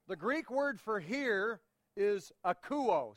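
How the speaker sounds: akouo said slowly, each vowel clear: a-KOU-o; slow, clear Greek word akouo; Greek lesson. Shown as a demonstration. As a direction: teach akouo carefully as a new word. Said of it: "a little Greek lesson".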